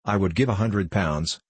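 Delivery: ordinary delivery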